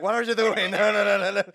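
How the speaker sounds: high, loud mock yelling; retold yell. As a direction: imitate a sudden panicked outburst. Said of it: "you immediately yell".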